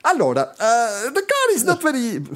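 high, slow, hammed-up Italian English; Italian accent English. Valentino answering the turn six question in heavy Italian accent. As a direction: drag out allora like a flustered rider.

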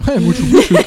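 loud, high delighted echo; excited shout. Real, not acted. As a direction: belt the silly name out gleefully. Gleeful echo of silly name.

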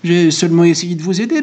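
high, mildly flustered protest; stammering barber. A narrator's reading. Barber excuses himself to Goludja, mildly stammering. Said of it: "bégaya le barbier".

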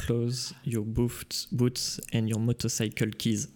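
slow English with a heavy French accent, imitating Terminator; Terminator line in a French accent. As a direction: growl out the Terminator quote slowly in frenchified English. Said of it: "Avec mon accent, s'il vous plaît". (imitation de Terminator, lente, avec un fort accent français)

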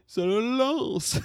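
high, loud, stretched-out wail; echoing film character. Retold or imitated. Other character repeating the toothache complaint, wailing echo. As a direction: drag the word out in mock agony. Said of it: "l'autre qui reprenait".

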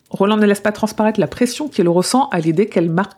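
ordinary talk, nothing marked

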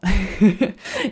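short amused laugh; light laugh. Real, not acted. Laughs after "et voilà. (petit rire)

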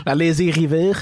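lazy river in a Quebec French accent. Shown as a demonstration. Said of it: "Tu peux le dire avec un accent québécois".

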